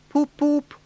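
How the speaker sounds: high wailing motor horn, poop poop; high wailing horn imitation; car horn wail. A narrator's reading. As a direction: hoot it like a distant car horn in pain. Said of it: "wailed like an uneasy animal in pain".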